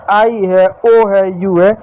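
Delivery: ordinary talk, nothing marked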